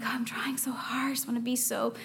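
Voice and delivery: whispered, straining self-talk; mock a believer trying too hard under their breath; anxious inner voice. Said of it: "you're like".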